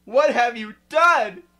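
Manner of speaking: loud, slow, stern demand; angry. Acted. Dumbledore confronting the girl, angry. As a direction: boom it out in anger. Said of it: "walked in angrily".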